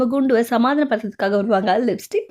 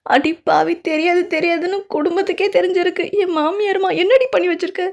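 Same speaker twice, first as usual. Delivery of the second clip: much higher, scolding put-on voice; outraged wife; 8 semitones higher than usual. Wife scolds her husband for telling the whole family, outraged high voice. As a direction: scold them in disbelief.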